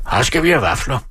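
restrained high call. A narrator's reading. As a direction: call it out eagerly without real volume. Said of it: "råbte Bay".

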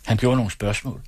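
usual speaking voice

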